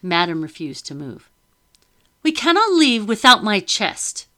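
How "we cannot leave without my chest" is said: higher, louder haughty lady refusing; indignant lady. A narrator's reading. Wife refusing her husband: high haughty protest. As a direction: dig in, high and imperious.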